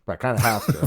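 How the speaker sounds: talking through a mouthful; chewing while speaking; mouth full. Real, not acted. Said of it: "I don't wanna talk with my mouth full".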